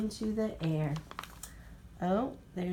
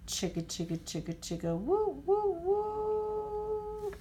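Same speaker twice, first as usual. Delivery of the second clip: chug quietly, then toot the whistle high; choo-choo train sound, chug then whistle; train chugging and whistle; 10 semitones higher, 4 dB quieter than usual. A narrator's reading.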